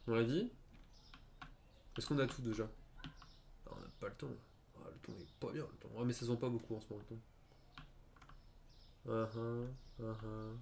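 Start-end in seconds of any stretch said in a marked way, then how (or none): 3.1-5.9 unvoiced whispered aside; breathy whispered mutter. Real, not acted. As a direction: whisper it under your breath. Side remark in whisper.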